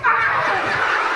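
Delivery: high-pitched yelp; comic pain yell. Pretend. Magician snapped while child holds balloon, pained yell. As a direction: cry out in mock pain. Balloon snaps a second time.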